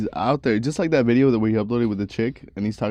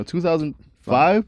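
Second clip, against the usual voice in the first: higher, drawn out, FIVE swung up into a question; questioning rise; 6 semitones higher than usual. Retold or imitated. Host mimicking girl's birth year answer, questioning "five". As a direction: exaggerate the question mark on "five". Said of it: "with a question mark at the end".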